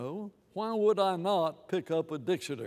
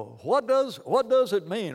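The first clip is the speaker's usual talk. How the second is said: louder, raised quote asking "what does it mean"; loud questioning quote; 5 semitones higher, 5 dB louder than usual. Retold or imitated. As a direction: call the question out. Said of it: "Paul in another place says".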